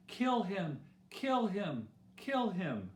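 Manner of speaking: insistent but restrained storyteller's yell; crowd yelling. A narrator's reading. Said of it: "The crowd just kept yelling".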